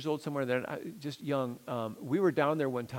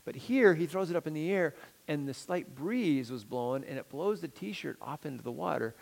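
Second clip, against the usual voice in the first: normal talking voice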